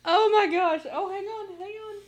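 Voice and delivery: high, breathless laughter; can't stop laughing. Real, not acted. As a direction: giggle out the words. Still laughing.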